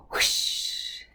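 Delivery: soft breathy whoosh of speed. Acted. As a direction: breathe out the rush of wind. Skateboard rush sound effect.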